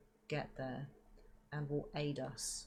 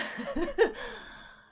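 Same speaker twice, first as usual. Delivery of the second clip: airy giggle, pitched way up; high breathy laugh; 9 dB louder than usual. Real, not acted. Laughing after the sneeze.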